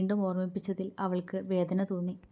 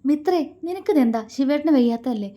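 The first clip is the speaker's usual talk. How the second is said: high, loud rebuke; raised voice; 6 semitones higher, 6 dB louder than usual. A narrator's reading. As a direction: scold her sharply. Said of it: "Bhadra മിത്രയ്ക്ക് നേരെ ഒച്ച ഉയർത്തി".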